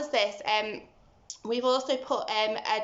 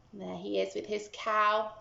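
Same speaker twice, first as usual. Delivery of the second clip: usual speaking voice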